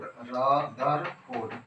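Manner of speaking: Rutherford stretched out very slowly; drawn out slowly. Shown as a demonstration. Teacher repeating Rutherford slowly for students. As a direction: lengthen Rutherford for dictation. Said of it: "क्या बोलने का है".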